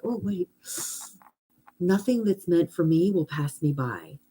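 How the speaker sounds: soft, calming reminder to yourself; pause, then settle softly into the affirmation; peaceful self-reassurance. Pretend. Answering her worries with an affirmation, softly reassuring. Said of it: "how much peace does that give you".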